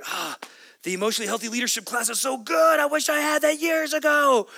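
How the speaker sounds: high excited gushing voice; quoting Lauren. Retold or imitated. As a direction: gush like an eager young woman. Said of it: "she was like".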